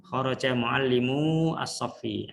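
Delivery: Kharaja muallimu as-saffi read slowly with muallimu leaned on; slow, clear classroom Arabic; slow model reading. Shown as a demonstration. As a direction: read it out deliberately like a teacher. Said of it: "Jadi bacanya gitu ya".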